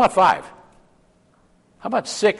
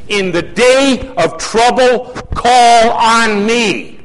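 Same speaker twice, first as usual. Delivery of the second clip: slow, booming voice of God, higher and louder; 8 semitones higher, 5 dB louder, much slower than usual. Acted. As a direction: proclaim it slowly as God calling out. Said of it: "God says".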